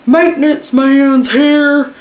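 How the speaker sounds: put-on slow, high, loud call of an old man; drawn out announcement yell, hammed up; old man's yell. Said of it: "and he yells".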